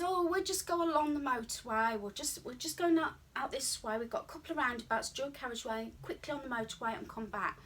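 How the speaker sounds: a hint of a chirpy driving instructor brushing off the motorway; breezy instructor. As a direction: play the upbeat instructor making it sound easy. Instructor reassuring her before the drive.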